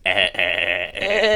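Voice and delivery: loud repeated "eh" cackle; Papyrus's laugh. Retold or imitated. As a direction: overdramatic skeleton's "nyeh-heh" laugh. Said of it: "he goes".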